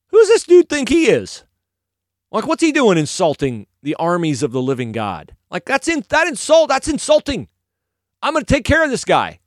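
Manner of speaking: indignant, high and loud; hammed-up young man's outrage; David. David hearing the giant's insults, indignant. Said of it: "And David's reaction is".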